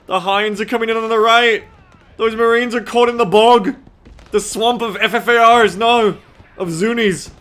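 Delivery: high, loud panicked battle cries; loud gung-ho soldier. Pretend. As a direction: yell it like a soldier under fire. Mock battlefield callouts.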